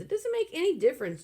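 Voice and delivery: quick high shout; fast yell. A narrator's reading. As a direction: snap it out fast. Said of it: "Joe yelled at him".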